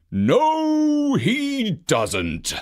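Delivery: high boom from a stern boss. A narrator's reading. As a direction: make the chief's entrance boom. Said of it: "boomed a loud voice".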